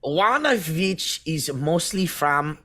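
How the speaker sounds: high put-on French accent drifting German; a clumsy fake French accent; mock French accent. Reading the news to friends in a fake French accent. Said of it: "it's starting to sound like German".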